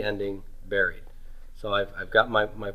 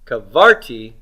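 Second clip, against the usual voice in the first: kavarti with VAR punched louder; stress on middle syllable; 3 dB louder than usual. Shown as a demonstration. Teacher offers options for where the accent goes, kavarti stressed on the middle syllable. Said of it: "Where's the accent go on this?".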